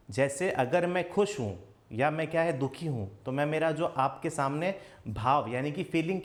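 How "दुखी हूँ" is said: usual speaking voice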